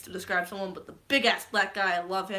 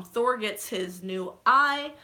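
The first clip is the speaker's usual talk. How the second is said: ordinary talk, nothing marked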